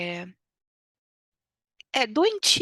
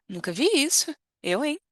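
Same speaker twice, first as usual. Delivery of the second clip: scandalised disbelief; 6 semitones higher than usual. Shown as a demonstration. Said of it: "é tipo".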